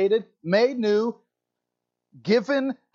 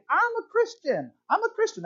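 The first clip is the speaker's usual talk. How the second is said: mock confession; 14 semitones higher than usual. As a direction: play the eager new believer declaring it. Voicing a believer's confession.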